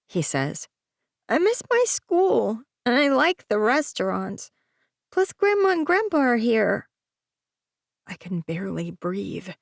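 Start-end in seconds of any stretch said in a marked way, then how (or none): 1.3-6.9 put-on high, pleading little boy; young boy voice. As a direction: plead like a worried child. Child son voiced in story.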